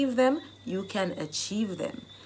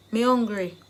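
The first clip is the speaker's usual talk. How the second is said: me hungry in Jamaican patois, louder; 2 semitones higher, 4 dB louder than usual. Shown as a demonstration. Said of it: "the Jamaican dialect phrase".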